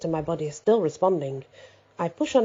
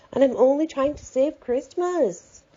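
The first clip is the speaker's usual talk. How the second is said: pleading, higher; 8 semitones higher, slower than usual. A narrator's reading. Narrator pleads, raised pitch.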